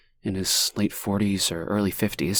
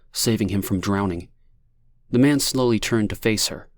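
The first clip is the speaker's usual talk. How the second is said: plain reading voice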